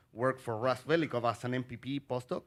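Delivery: ordinary delivery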